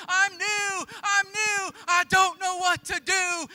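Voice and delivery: high, loud, wailed movie quote; hammed-up cry of a clueless rookie; panicked Joe Dirt. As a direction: wail it helplessly, stretching the final words. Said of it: "I felt like Joe Dirt".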